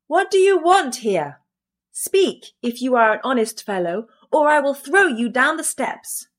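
high-pitched threatening challenge; crying out. A narrator's reading. Boy threatening the motionless ghost, crying out. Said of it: "The boy cried a second time".

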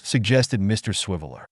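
plain reading voice

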